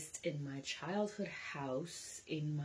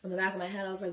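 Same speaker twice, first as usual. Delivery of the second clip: ordinary delivery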